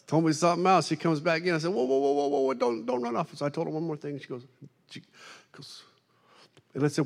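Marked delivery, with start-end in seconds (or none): 1.7-3.3 higher, hurried whoa; urgent pleading. Retold or imitated. Preacher begging the waitress not to run, higher. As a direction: stop her before she runs.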